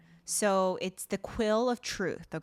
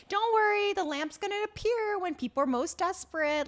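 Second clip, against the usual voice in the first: high-pitched mock reassurance; retold breezy reassurance; 11 semitones higher than usual. Genie tells her not to worry, breezy. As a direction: brush off the worry in a raised voice.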